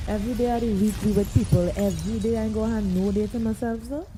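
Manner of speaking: muffled mic sound. Real, not acted. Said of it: "Why you sounding muffled".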